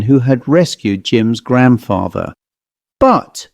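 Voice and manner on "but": sudden high exclaimed protest; exclaiming. Acted. Gilbert objects to the old man's age, exclaiming high. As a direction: blurt out the objection in surprise. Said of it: "exclaimed Gilbert".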